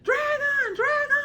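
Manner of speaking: high, crying alarm shouts; mock panicked screams; panicked crowd. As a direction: townsfolk screaming at the sky. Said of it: "all you hear are people saying".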